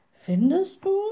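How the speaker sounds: high, questioning little witch; high-voiced young witch character. A narrator's reading. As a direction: pitch it up into a sceptical question. Little witch answers the raven.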